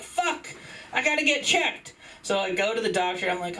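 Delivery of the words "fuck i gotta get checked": high, squeezed fretting; mock panic. Comic reacting to girlfriend's warning, high mock panic. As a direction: act out a sudden comic worry. Acting his alarm at the news.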